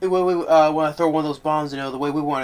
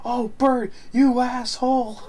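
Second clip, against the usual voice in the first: much higher, slow mock outrage; angry yell at Bert; 11 semitones higher, slower than usual. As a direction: drag out the insult in outrage. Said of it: "he still like".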